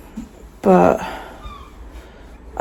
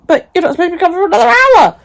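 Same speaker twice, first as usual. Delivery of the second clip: retold shock; 9 semitones higher, 3 dB louder, much faster than usual. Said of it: "I'm like".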